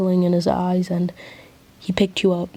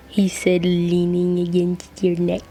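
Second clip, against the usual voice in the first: usual speaking voice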